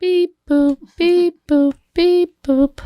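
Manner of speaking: high, sustained electronic beeps; playful beep-boop filler. Pretend. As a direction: sing out little computer beeps. Filling the wait while guest thinks.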